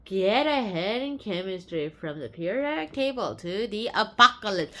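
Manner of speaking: ordinary talk, nothing marked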